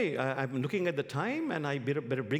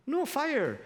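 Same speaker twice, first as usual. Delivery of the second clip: insistent angel; 12 semitones higher, 4 dB louder than usual. Acted. Angel insists there is none.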